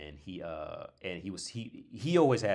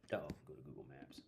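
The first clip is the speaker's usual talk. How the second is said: whisper; 5 semitones lower, 12 dB quieter than usual. Real, not acted. Whispering while searching the map.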